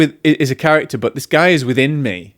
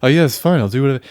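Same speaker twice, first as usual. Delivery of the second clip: lightly panicked, eager-to-help husband voice; a touch of hysteria while offering to help; Darling character; faster than usual. Shown as a demonstration. Showing the character's panicked helpfulness to his darling, lightly hammed-up fluster. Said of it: "the kind of hysteria and the panic".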